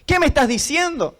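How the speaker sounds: pleading with God, pitch climbing; retold fed-up protest in prayer; desperate complaint. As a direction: argue with God at wit's end. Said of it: "Así hablaba con Dios".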